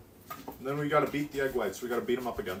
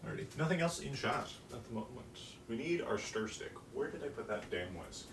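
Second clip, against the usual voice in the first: ordinary talk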